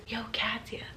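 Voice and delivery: softly voiced coworker leaning in; imitated whisper. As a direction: murmur his name like a creepy coworker. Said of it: "comes up and whispers".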